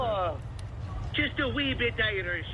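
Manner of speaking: high mock Irish lilt; a hammed-up Irish brogue; playful leprechaun Irish; Irish accent. Shown as a demonstration. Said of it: "Irish, man".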